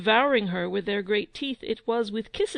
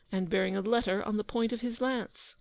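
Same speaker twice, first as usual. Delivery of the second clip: usual speaking voice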